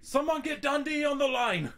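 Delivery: a hammed-up Australian farmer voice, high and excited; mock Australian farmer.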